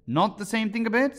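high, loud rebuttal; contradicting. A narrator's reading. Hatter contradicting Alice, high and loud. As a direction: knock her claim flat.

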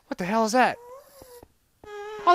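ordinary delivery

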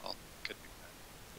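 slow, near-whispered mumble; barely audible, whispery muttering; super quiet mumble. Real, not acted. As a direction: mumble it almost without voice. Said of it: "Now you sound super quiet".

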